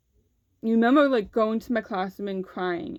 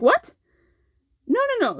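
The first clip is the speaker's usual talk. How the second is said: sudden high, loud "What?" then high "No, no, no"; jolted by the accusation; high-pitched astonished denial; shocked disbelief; 13 semitones higher, 4 dB louder than usual. Retold or imitated. Accused of seeking attention, shocked.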